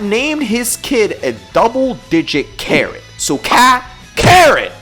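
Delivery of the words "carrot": ordinary talk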